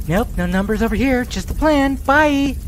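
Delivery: lightly voiced exec brushing off the question; a hint of a dismissive studio boss; mock Ann Sarnoff. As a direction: pretend studio chief answering fans, mildly voiced. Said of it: "Ann Sarnoff?".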